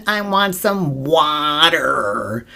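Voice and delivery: slow, drawn-out American "I want some water" with a hard r in "water"; mock American accent, "wɑtə˞". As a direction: imitate the daughter's American "water", slowly. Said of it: "the way she says water".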